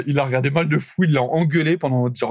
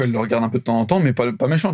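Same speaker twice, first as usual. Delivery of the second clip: usual speaking voice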